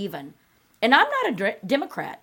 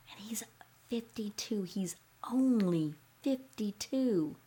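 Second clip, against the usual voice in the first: whisper; 7 dB quieter than usual. Real, not acted. Comic whispering in disbelief about the singer's age.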